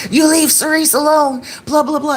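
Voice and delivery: high, scolding mock telling-off; indignant retelling. Friend steps in to defend her, indignant and high-pitched retelling. Upset friend confronts the girl.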